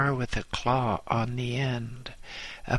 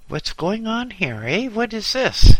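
high, loud shouted demand; French policeman shouting at the brawling crowd; policeman's demand; 10 semitones higher, 11 dB louder than usual. Acted. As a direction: burst in barking at the crowd.

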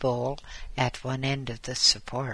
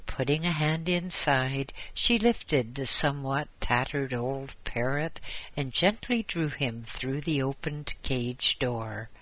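usual speaking voice

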